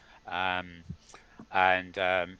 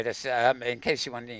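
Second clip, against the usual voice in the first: ordinary delivery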